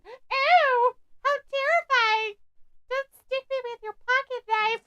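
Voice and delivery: high-pitched sarcastic squeal of fright; hammed-up fear of a silly threat; mock terror. Mocking the feared roadmen.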